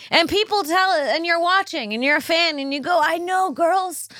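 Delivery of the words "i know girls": fan voice. Retold or imitated. Fan talking to the screen.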